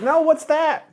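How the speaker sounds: put-on very high, innocent little-kid voice; clueless kid. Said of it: "I was like".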